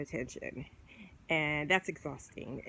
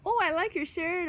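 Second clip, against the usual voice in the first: higher, sugary mock compliment; butter them up, pitched up; 11 semitones higher than usual. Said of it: "All you gotta do is go".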